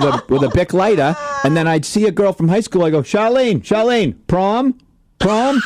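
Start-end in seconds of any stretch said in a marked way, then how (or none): 3.0-5.6 calling out. Retold or imitated. Calling out to girl in car.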